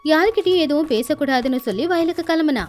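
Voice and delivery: ordinary delivery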